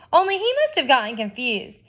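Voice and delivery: ordinary delivery, nothing marked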